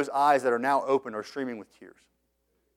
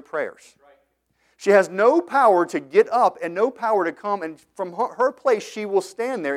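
raised, forceful pulpit delivery; impassioned preaching; 6 semitones higher, 5 dB louder than usual. Real, not acted. Preacher denouncing a powerless idol to his congregation, impassioned. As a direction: preach it high and insistent, building on each phrase.

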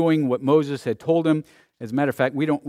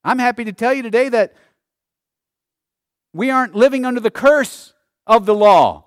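loud, high pulpit cry; impassioned preacher, shouting the declarations; full-force preaching; 9 semitones higher, 10 dB louder than usual. Real, not acted. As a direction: drive it home to the whole congregation.

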